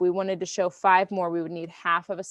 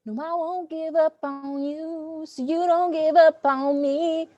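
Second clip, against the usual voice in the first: encouraging; 8 semitones higher, 3 dB louder, slower than usual. Real, not acted. Encouraging a student not quitting.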